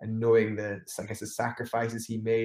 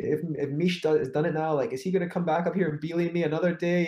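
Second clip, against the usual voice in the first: anxious thoughts; 6 semitones higher, faster than usual. Retold or imitated. Anxious inner thoughts retold.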